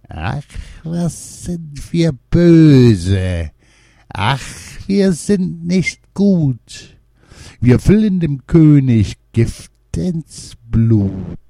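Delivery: high, slow, sly gnome chant; gnome voices murmuring. A narrator's reading. Three gnomes gloating over the sleeping king, high and slow. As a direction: creep out the wicked rhyme like scheming gnomes. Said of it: "Dabei murmelten sie leise".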